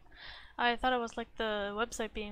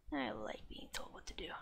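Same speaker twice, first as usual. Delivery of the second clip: whispered aside to herself; whispering; 5 semitones lower, 10 dB quieter than usual. Real, not acted. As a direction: breathe it as a sheepish confession. Muttered self-deprecating aside.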